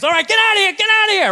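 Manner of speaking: high, loud gruff shoo-off; shooing trainer. Retold or imitated. Trainer shooing the owner away, loud and high. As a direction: chase him away like a bouncer.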